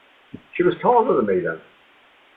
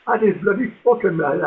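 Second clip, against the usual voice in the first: normal talking voice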